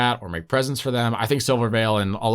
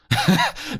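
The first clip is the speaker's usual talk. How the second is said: high squealing laugh. Real, not acted. Laughing about going deaf.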